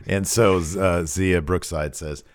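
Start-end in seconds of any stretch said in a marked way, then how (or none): none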